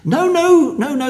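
high-pitched, hurried-to-correct retold protest; imitated high, emphatic objection; protesting denial. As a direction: mimic them jumping in to deny it. Church members correcting his mistaken assumption.

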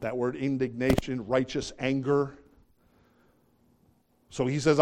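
usual speaking voice

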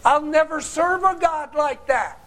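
mock the rebel's proud vow; defiant vow. The preacher mimics people refusing God, defiant. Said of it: "they say".

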